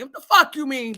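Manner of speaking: usual speaking voice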